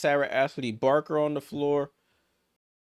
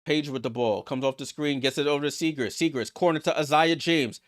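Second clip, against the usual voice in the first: ordinary talk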